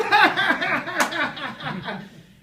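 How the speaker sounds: big high-pitched cackle after the joke; long hooting laugh at a punchline; high loud laugh. Retold or imitated.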